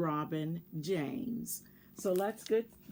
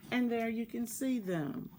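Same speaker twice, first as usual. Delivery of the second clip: usual speaking voice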